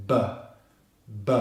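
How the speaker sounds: isolated short "b, b" bursts; voiced B. Shown as a demonstration. Teacher isolating the consonant for learners, short voiced "b". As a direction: pop a short "b" from the lips, twice. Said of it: "the voiced B consonant".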